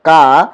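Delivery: का stretched slowly, long aa held; drawn-out demo of का. Shown as a demonstration. Teacher slowly demonstrates का for students. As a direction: pronounce का slowly so its long vowel is heard. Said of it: "जब हम का बोलते हैं".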